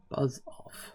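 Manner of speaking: whispering. Pretend. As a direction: hiss it under your breath.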